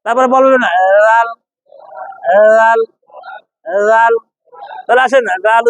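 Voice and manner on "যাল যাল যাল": gentle "dhaal" for লাল; tongue tip out. Shown as a demonstration. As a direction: push the tip past the teeth for the start of লাল. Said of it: "মুখ থেকে দাঁতের বাহিরে".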